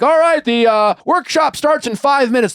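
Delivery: high, loud announcer call across the store; mock store announcement yell. Employee on microphone announcing workshop to the whole store, mock yell. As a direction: imitate a guy booming over the store mic. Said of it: "on a microphone yelling out".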